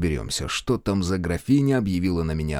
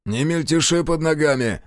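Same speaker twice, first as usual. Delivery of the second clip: growled order; 7 semitones higher than usual. A narrator's reading. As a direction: growl it at the servant. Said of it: "рыкнул сержант".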